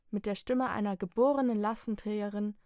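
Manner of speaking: plain reading voice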